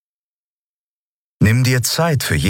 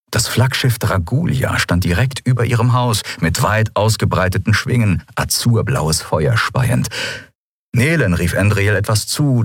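ordinary delivery, nothing marked

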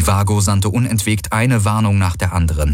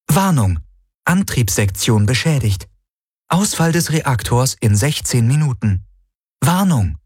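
lightly voiced, higher computer announcement; ship computer warning; 5 semitones higher than usual. A narrator's reading. Ship warns crew of reactor failure, announcing. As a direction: separate each announcement, pitch falling at the end.

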